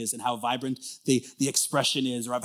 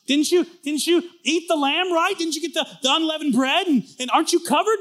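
jazzed; 8 dB louder than usual. Acted. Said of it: "the second guy goes".

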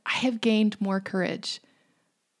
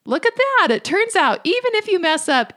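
upbeat encouragement; 9 semitones higher, 5 dB louder than usual. Retold or imitated. Mom cheering up her daughter.

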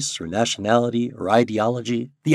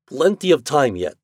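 put-on Russian accent; 6 semitones higher, 5 dB louder than usual. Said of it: "Pitov was speaking German".

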